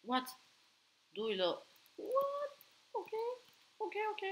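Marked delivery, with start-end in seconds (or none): 2.0-2.6 what perché très haut, d'un coup; lâche un what suraigu de stupeur; what aigu surpris. Real, not acted. Cri aigu surpris en jeu. 2.9-4.2 ok ok lancés très haut; ok aigu. Real, not acted. Réaction surprise en jeu.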